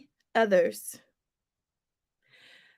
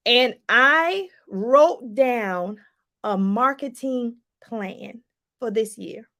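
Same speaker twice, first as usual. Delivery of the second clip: slow emphasis; 3 semitones lower, much slower than usual. Shown as a demonstration. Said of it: "slow deliberate emphasis".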